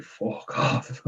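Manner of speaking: breathy mock "fuck off" under the breath; muttered under breath. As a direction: say it under your breath, almost voiceless. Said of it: "I was kinda like".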